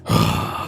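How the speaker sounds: long low sigh of a miserable man; deep worn-out sigh; weary sigh. Retold or imitated. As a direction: let out a heavy defeated sigh. Miserable man getting off bus.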